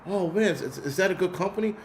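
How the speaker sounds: high mock voice of the inquiring woman; imitated old woman. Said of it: "And she goes".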